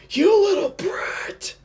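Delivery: high, strained mock scream at no real volume; enraged yell. Fan voicing an enraged demon at his young foe, strained mock yell. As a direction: squeeze out a slow, enraged squeal. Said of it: "he yells".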